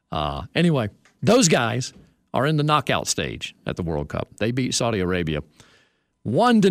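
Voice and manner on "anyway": ordinary talk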